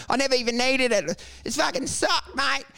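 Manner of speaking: high mock bitter ex-comic; mocking the quitter's online complaining; mocking whine. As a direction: whine it like a sore loser. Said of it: "reaching out to heroin addicts going".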